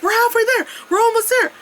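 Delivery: high, mock-encouraging voice; mocking Paul's cheer. Friend urging him up the stairs, high mock cheer. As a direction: imitate a pushy cheerful friend. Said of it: "Paul kept saying".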